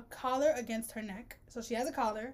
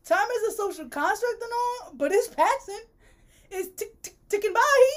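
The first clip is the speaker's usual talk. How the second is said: high-pitched, building to a loud finish; mock-panicked rant; 9 semitones higher, 6 dB louder than usual. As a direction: wail about time slipping away, hammed-up. Mock rant about time passing.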